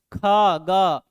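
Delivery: खा and गा loud from the throat; sound demo. Shown as a demonstration. Teacher contrasting खा and गा for pupils, loud. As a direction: call खा then गा from the throat. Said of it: "एक हमारा क्या हो गया?".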